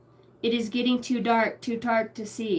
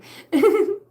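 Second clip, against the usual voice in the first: 1 laughing; 10 semitones higher, 8 dB louder than usual. Real, not acted. Bursts out laughing.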